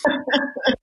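short, self-mocking chuckle; high amused laugh. Real, not acted. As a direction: laugh at your younger self. Laughs at his teen self.

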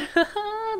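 high squeaky giggle over something cute. Real, not acted. Cute villager makes her laugh.

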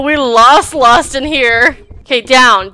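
ordinary talk

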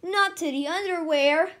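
alarmed correction; exclaiming. A narrator's reading. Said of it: "exclaimed Skipito".

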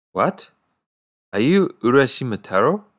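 the villager taken aback by the stranger's name; bewildered. A narrator's reading. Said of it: "A very bewildered expression came over the face".

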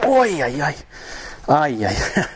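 high "oy, ay, ay" yelps of real pain; drawn-out ouch noises, high then falling. Real, not acted. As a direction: yelp from a knock to the knee. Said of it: "Hurt my knee there".

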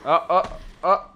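nervous laughter; laughing. Real, not acted. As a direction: laugh in disbelief. Laughing during fight.

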